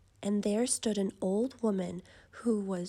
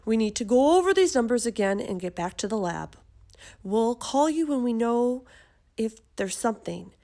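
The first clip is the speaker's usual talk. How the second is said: hurried experts; 7 dB louder than usual. A narrator's reading. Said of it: "the experts didn't have a clue".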